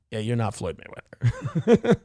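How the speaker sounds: a laughing mock put-down. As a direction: tease them through a chuckle. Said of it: "They go".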